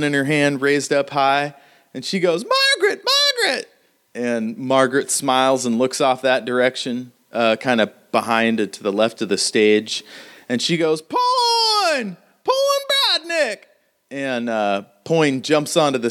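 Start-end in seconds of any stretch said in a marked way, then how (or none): 2.5-3.7 high falsetto shout of a name; calling out a name. Acted. Running woman calls to Margaret, high falsetto shout. 11.0-13.6 very high falsetto call, drawn out slowly; Margaret calling out. Acted. Margaret summons Poin across the stage, slow high call.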